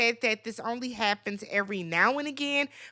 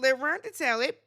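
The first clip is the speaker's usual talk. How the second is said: usual speaking voice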